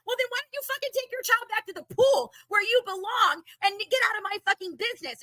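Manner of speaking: angry shout. Retold or imitated. Telling off a mother at the pool, shouting. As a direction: hurl it back at her like in the fight. Retold fight with a woman.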